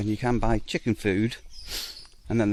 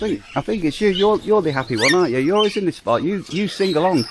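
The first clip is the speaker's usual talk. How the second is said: higher, louder sing-song pet talk; talking to a bird; 7 semitones higher, 4 dB louder than usual. Real, not acted. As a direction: cheerfully coax the cockatoo. Chatting to the cockatoo.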